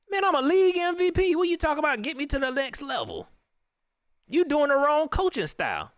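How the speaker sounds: high indignant player voice; indignant scoff. Retold or imitated.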